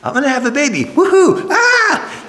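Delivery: high giddy mock squeal with a laugh; excited squeal. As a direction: squeal like a thrilled new mom. Mary imagined, excited squeal.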